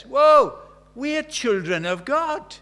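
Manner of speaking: loud, high, slow and amazed; thrilled. Acted. John marvelling to the believers, thrilled and loud. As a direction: let the wonder burst out slowly. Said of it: "He's thrilled".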